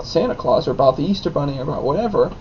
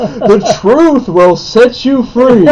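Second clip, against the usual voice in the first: slow, loud, higher proclamation; hammed-up sermon line; mock preacher; 5 semitones higher, 7 dB louder, much slower than usual. Son jokingly preaches truth to his father, slow and grand. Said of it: "proclaiming, then laughing".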